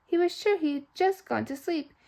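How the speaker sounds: ordinary delivery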